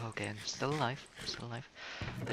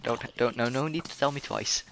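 ordinary talk

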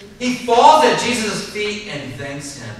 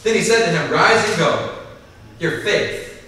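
raised, forceful Bible reading to the congregation; scripture reading; 2 semitones higher, 4 dB louder than usual. Acted. Preacher reading Luke to the congregation, forceful scripture reading. Pastor reads Luke seventeen aloud.

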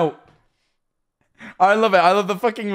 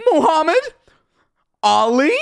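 mock awed surprise; 9 semitones higher, 4 dB louder than usual. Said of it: "and he's like".

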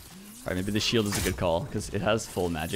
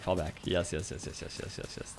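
ordinary delivery

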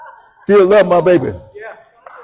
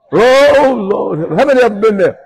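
higher, louder, fast exclamation; dismayed cry; 9 semitones higher, 6 dB louder than usual. Retold or imitated. Driver watching officer write tickets, higher dismayed cry.

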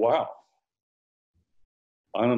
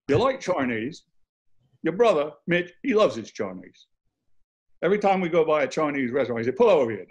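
imitated Cockney driver, higher and punchy; Cockney accent; 6 semitones higher than usual. Driver telling passenger about his brother, put-on Cockney. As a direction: do a chatty London Cockney. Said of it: "a Cockney accent".

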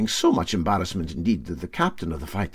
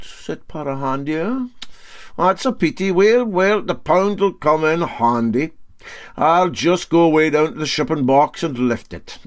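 higher, louder, mock-regretful skipper; Para Handy, smug; 6 semitones higher, 4 dB louder than usual. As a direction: feign pity while grabbing the pound. Para Handy claims the prize.

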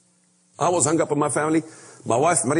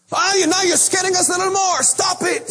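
high, fast mock protest; hammed-up panicky church leader; mock alarmed pastor; 11 semitones higher than usual. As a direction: squeal it like a scared pastor. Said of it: "pastor mocking alarm".

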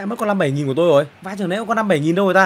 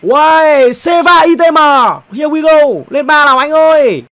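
louder and higher, cheering on the upgrade; hyped cheer; 6 semitones higher, 8 dB louder, slower than usual. Real, not acted. Streamer cheering his card upgrade, hyped. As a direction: urge the card upward.

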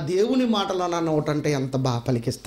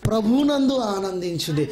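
solemn scripture reading; 5 semitones higher than usual. Acted. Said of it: "నాలుగో అధ్యాయము నాలుగో వచనం చూడండి".

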